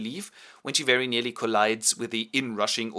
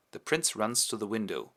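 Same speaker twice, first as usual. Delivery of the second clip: plain reading voice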